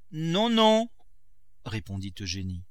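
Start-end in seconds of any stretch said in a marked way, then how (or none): 0.1-0.9 high, loud refusal; called-back reply. Acted. Daughter answering the cook through the window, high called reply. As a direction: call back from a distance.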